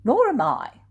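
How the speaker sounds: Matthew refusing. Acted. Matthew joining Peter's refusal, huffy. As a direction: toss back a proud refusal. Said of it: "said Matthew".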